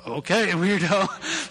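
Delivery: wife teasing him through a laugh; wife laughing at his odd rush to the building; wife amused, slow. Retold or imitated. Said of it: "And she's like".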